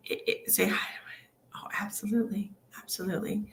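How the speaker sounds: soft unvoiced whispering. Real, not acted. Medium whispering back to the dad's spirit. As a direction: whisper the reply under breath.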